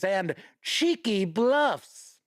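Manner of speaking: playful stress on cheeky; savoring the word. Shown as a demonstration. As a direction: lean into cheeky bluffs with relish. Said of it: "Ooh, cheeky, eh?".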